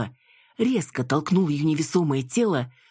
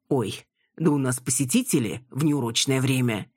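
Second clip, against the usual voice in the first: ordinary delivery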